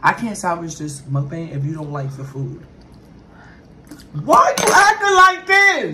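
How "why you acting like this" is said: high, slow, loud whine; high pleading whine. Acted. Cook pleading over disliked food.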